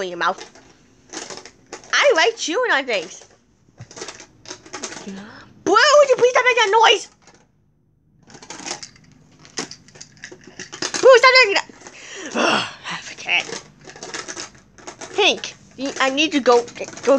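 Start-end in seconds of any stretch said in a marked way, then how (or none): 5.6-11.7 high, loud exasperated order; scolding. Pretend. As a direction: tell off a noisy child in play. Scolding Boo for noise.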